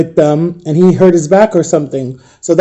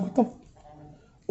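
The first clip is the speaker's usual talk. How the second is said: barely voiced, cut-off whisper; stifled near-swear in a whisper; swallowed curse; 18 dB quieter, much slower than usual. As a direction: mouth the curse under your breath, stop short. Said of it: "I wanted to curse so bad".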